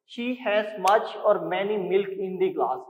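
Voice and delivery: very slow, word by word reading; slow dictation. Shown as a demonstration. Teacher reading the sentence to students, slow dictation. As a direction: spell it out slowly for the class.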